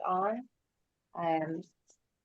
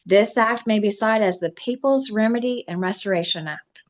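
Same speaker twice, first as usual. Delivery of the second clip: louder, declaimed title citation; formal proclamation; 3 semitones higher, 9 dB louder than usual. Acted. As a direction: proclaim the act's name to the room.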